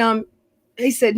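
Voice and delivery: normal talking voice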